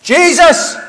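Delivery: high, loud call of the name; shout. Shown as a demonstration. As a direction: cry out the name as loud as you can. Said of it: "but he shouted all the more".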